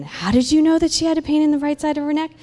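high, loud, fast question; astonished demand. Retold or imitated. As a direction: imitate someone amazed and needing an answer. Retold woman astonished, demands explanation.

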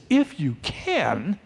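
slow, questioning echo; softly taken aback, stretched out; incredulous reaction. Acted. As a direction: throw the words back, drawn out and rising. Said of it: "Jesus reacts to this".

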